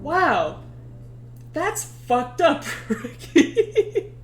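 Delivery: slow, high wow breaking into laughter; mock Ulysses cracking up; laughing imitation. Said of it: "Ulysses is like".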